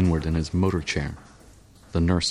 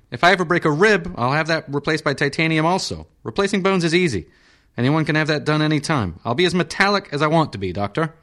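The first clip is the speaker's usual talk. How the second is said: high, fast peevish patient voice; irritable senator; 10 semitones higher than usual. Acted. Senator insisting to the surgeon on metal, high peevish. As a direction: demand his metal heart, higher.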